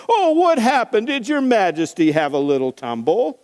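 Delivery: high, loud, sneering soldier taunts; mocking Roman soldier. Soldier mocking Jesus, sneering and loud. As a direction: taunt him like a cruel guard. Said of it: "writes this as a dramatization".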